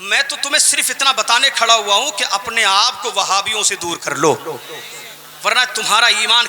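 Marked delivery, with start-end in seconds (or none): none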